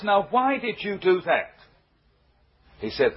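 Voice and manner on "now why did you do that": retold indignant demand, pitched higher; mock-angry policeman questioning a boy; mad policeman. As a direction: act out a mildly outraged telling-off. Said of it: "Well, he was mad".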